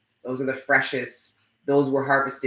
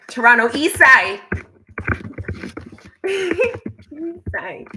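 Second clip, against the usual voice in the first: loud, much higher cheer breaking into laughter; hometown hype; 12 semitones higher, 6 dB louder, slower than usual. Real, not acted. Streamer hyping her own Toronto neighbourhood, laughing cheer. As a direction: rep your hometown proudly.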